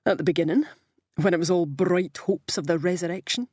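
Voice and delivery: mildly cheery quip; cheerful. A narrator's reading. Brian carrying on his joke to Morrow, cheerful. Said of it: "said Brian cheerfully".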